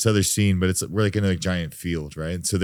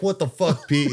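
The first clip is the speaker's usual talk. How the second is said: exasperated complaint; 8 semitones higher than usual. Retold or imitated.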